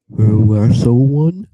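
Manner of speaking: put-on a teen boy blurting it out; Ron voice.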